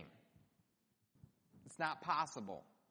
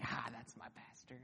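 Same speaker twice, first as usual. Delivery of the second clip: soft, lower aside of recognition; hushed recognition; 4 semitones lower, 9 dB quieter than usual. Acted. Churchgoer spotting the pastor in town, hushed. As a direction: say it under your breath, pointing someone out.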